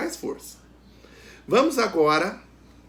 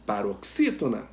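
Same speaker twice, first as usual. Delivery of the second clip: ordinary delivery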